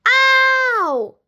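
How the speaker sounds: sudden high yelp of pain; pained scream. A narrator's reading. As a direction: cry out as if hit. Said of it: "somebody screamed".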